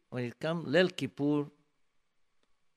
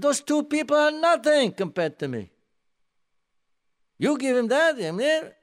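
higher and louder, indignant shouting; furious outburst; 11 semitones higher, 7 dB louder than usual. Retold or imitated. Offended man yelling at the beadle over his honor, furious. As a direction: explode at the beadle over the insult. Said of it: "And he screams at him".

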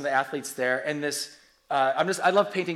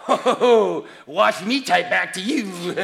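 loud, hammed-up villainous gloating; mock vengeful relish; 7 dB louder than usual. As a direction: relish the comeback with slow, theatrical menace. Acts out urge to retaliate online.

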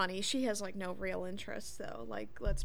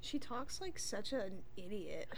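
ordinary talk

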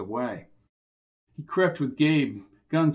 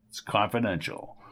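smug refusal; 5 semitones lower, 5 dB quieter than usual. Acted. Jerry refuses to answer.

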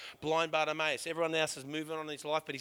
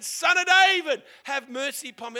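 shouting; 9 semitones higher, 13 dB louder than usual. Retold or imitated. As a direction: shout it again over the crowd. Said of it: "he shouts again".

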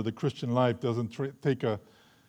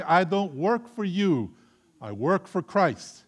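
slow, firm, each word weighted; slow firm declaration; 7 semitones higher, 6 dB louder, slower than usual. Shown as a demonstration. Preacher telling workers to say it to the boss, slow and firm. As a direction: say it like a vow to a boss.

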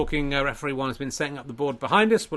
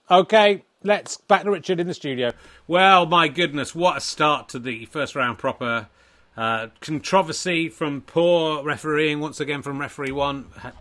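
host it like a TV snooker presenter; 5 dB louder than usual. Acted. Mock TV studio presenter voice.

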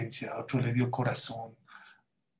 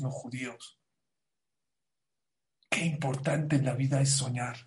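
ordinary delivery

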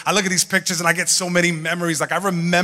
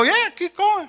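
mom's voice; 13 semitones higher, slower than usual. Retold or imitated. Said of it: "She go".

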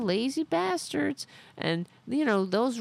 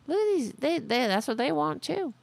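ordinary delivery

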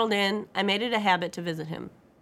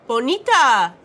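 delighted loud call, higher pitch; loud greeting; 5 semitones higher, 10 dB louder, slower than usual. A narrator's reading. Father greeting his visiting daughter with arms outstretched, loud. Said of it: "he said loudly".

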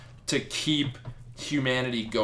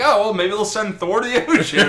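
higher, laughing joke voice; laughing mock quote; 7 semitones higher, 6 dB louder than usual. Podcaster recalls last week's joke, laughing. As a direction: giggle through the old joke. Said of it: "we're like".

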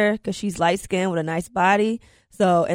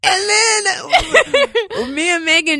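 laughing; 9 semitones higher, much slower than usual. Real, not acted. Recalling laughing fit together.

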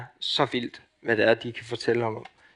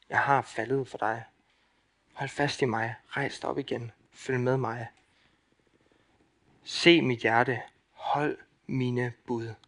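gentle Jesus; 4 dB quieter than usual. Acted. Jesus calling his followers out of darkness, softly and tenderly. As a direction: coax a lost child to hold on, softly. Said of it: "Det er det, Jesus han siger".

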